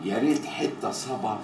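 very low and soft, with pauses; low, quiet request. Acted. Man waiting for the boss asks for a tune, low and quiet.

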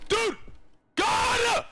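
high, loud yell of shock; sudden loud high cry; startled shout. Real, not acted. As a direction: yell it out in fright. Scared by the game.

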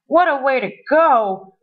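lightly wailing, mock despair; a storybook wail with little force; mild wail. A narrator's reading. Science teacher captured by monster, mildly wailing. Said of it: "wailed the science teacher".

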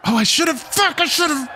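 high, worked-up self-reproach; exclaimed regret. Real, not acted. Realizing a drinking rule was missed, exclaiming. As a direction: cry out at a missed chance.

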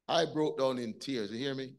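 normal talking voice

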